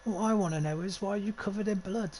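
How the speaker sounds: put-on high-pitched East London kid; questioning in a put-on East London accent. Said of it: "I'm kinda giving him that accent".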